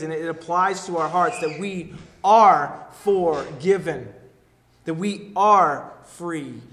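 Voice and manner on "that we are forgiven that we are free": slow, loud, hammering the word are; emphatic. Acted. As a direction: preach it, slowly and loud. Preacher driving home freedom.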